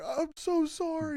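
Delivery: very high-pitched, put-on apology; mock apologetic driver. Driver answers the middle finger, high mock apology. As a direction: imitate a squeaky, pleading driver. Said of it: "He's like".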